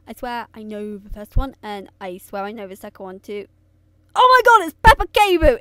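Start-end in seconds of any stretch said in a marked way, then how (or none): 4.1-5.6 loud, high sudden recognition; excited shout. Real, not acted. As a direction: burst out with delight.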